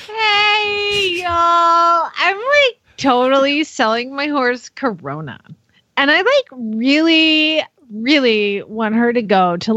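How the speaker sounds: put-on bubbly valley girl teen; teenager voice. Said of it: "as the best teenager voice that I have".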